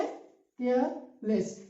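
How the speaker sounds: Careless split as care, pause, लेस; syllable break. Shown as a demonstration. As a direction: say careless in two separate beats. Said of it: "care पहले कर रहे हैं फिर लेस". (Careless को दो हिस्सों में, केयर और लेस)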